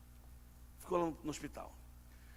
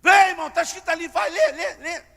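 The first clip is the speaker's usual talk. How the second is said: louder and higher, pushing them to read; loud urging; 7 semitones higher, 9 dB louder than usual. Real, not acted. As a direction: call out, rallying the church.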